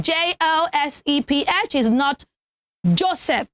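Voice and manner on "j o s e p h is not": ordinary delivery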